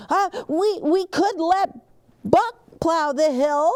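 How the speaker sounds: high, anxious little truck voice; Gus voice. A narrator's reading. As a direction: squeak it out nervously as little Gus. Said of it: "It's Big Buck".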